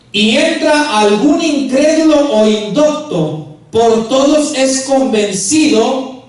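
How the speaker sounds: slow, loud preaching of the verse; scripture reading. Acted. Preacher reads Paul's words to the congregation, slow and loud. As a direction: proclaim each phrase slowly.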